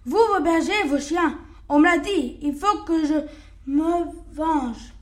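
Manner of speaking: wolf justifying his revenge, furious; raging wolf. Acted. Wolf rages, decides revenge.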